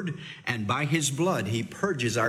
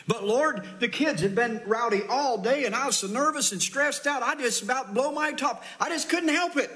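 higher, whining excuse prayer; whiny excuse; 9 semitones higher than usual. Retold or imitated. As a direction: plead that you couldn't help it, pitch climbing. Voicing a churchgoer's excuse to God.

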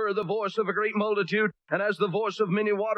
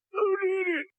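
high, thin voice of a battered man; high woozy hospital patient voice; dazed beaten man. Retold or imitated. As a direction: squeak it out like a man in a hospital bed. Beaten man in ICU speaks.

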